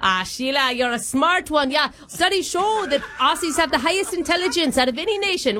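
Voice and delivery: put-on hammy broad Aussie accent; Australian accent. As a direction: lay on a thick Australian accent. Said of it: "Are you from Australia".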